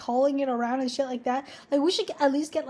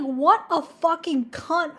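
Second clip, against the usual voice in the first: slow, mildly fuming imitation of mom; retold fuming; 3 semitones higher, slower than usual. Daughter imitating her mom fuming about the buyer, retold anger. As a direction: draw out the insult in mock outrage. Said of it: "She was so fucking mad".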